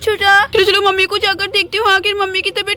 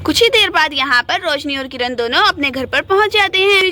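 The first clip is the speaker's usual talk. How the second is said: story narration; 5 semitones lower than usual. A narrator's reading.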